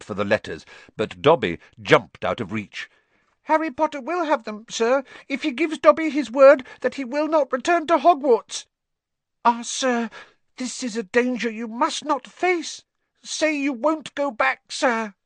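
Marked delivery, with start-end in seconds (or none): 3.4-15.1 high, pleading elf voice; squeaky house-elf. A narrator's reading. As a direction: beg like a timid little elf.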